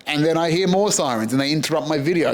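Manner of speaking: normal talking voice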